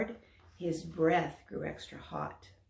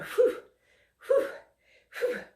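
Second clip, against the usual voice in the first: puffing; 4 dB louder than usual. Acted. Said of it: "trying to blow smoke like Dexter".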